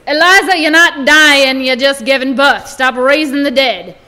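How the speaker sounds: loud, slow stern rebuke; stern scolding. Acted. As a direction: snap her out of it. Midwife scolds hysterical woman.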